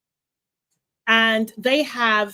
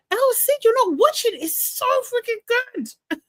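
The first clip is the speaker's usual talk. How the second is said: high excited whine; 12 semitones higher than usual. Real, not acted. Scolding viewer for not watching.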